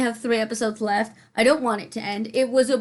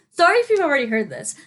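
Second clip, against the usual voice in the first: ordinary talk, nothing marked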